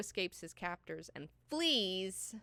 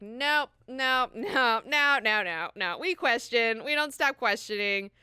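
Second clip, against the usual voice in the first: louder, higher string of refusals; defiant refusal; 4 semitones higher, 7 dB louder, slower than usual. Acted. Bene Gesserit refusing to stop questioning, defiant. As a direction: emphatically refuse to go along.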